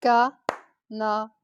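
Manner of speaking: Ca, na, pé very slow and separated; one beat each for Ca-na-pé; syllable by syllable. Shown as a demonstration. Teacher repeating the clapped word, syllable by syllable. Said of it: "Je répète".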